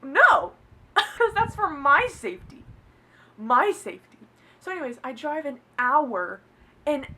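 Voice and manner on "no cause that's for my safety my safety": loud, high, laughing protest; indignant refusal. Retold or imitated. Refusing to buy him a knife, loudly indignant. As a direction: burst out with an amused, outraged no.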